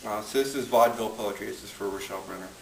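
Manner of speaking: normal talking voice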